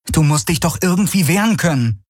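high-pitched insistent protest; exasperated heroine. A narrator's reading. Kirai argues with the helpless ship, exasperated.

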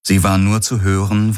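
ordinary delivery, nothing marked

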